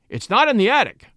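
acted insistent outburst; exasperated cry. A narrator's reading. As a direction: cry out in objection. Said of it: "cried Henry".

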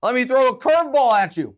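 loud, high, gloating ho ho; mock pundit gloat. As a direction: ham up a smug pundit springing a surprise. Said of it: "He was like".

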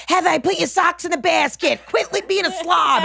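high scolding mom impression; nagging mom. Mother scolding her grown daughter, high scolding voice.

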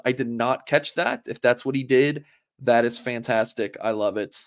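usual speaking voice

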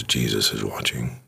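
low, soft eerie voice in the dark; a hint of a ghostly whisper. A narrator's reading. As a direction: let it drift out quietly from the darkness. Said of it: "and he hears".

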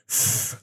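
"F" as a bare hissing fricative; "F" made as pure breath through lip and teeth; harsh F sound. Shown as a demonstration. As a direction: blow out a long voiceless "F". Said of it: "start with a harsh sound".

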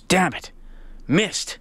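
lightly frustrated muttered curse, kept small; mild frustration. Retold or imitated. Golfer cursing his missed short putt, mildly frustrated. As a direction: retell a small flash of annoyance. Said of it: "showed a little frustration".

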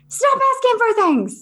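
put-on a harried mom begging the kids to stop; mock exasperated mom. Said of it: "I was like".

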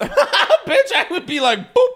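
shrieking laughter in words; laughing hard. Real, not acted. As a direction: squeal through the laugh. Laughing hard mid-line.

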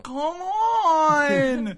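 high, loud whining wail; whiny wail. Retold or imitated. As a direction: wail it like a bratty teen. Mocking the singer's whine.

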